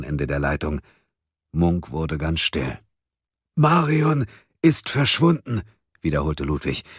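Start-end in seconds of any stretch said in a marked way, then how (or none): 3.6-5.6 slow, high, urgent delivery; emphatic repetition. A narrator's reading. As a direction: make the bad news sink in, slowly. Ludwig repeats the bad news. (langsam und eindringlich wiederholen)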